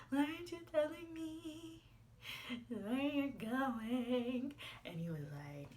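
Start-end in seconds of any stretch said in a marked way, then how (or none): 0.1-4.5 high, drawn-out whine; whiny demand. Retold or imitated. Girlfriend whining at boyfriend over hiding where he is, high and very slow. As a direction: stretch the question out, pleading and hurt. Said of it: "so I'm like".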